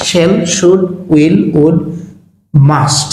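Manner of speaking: ordinary talk, nothing marked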